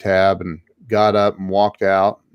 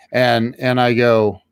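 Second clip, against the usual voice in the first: usual speaking voice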